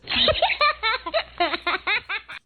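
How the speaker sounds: high giggling laugh; high playful giggle; child laugh. Acted. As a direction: giggle like a delighted little boy. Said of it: "Pip laughed".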